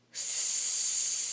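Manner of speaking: long, breathy monster hiss; hissing sound. A narrator's reading. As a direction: hiss like something lurking. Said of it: "a horrible hissing sound".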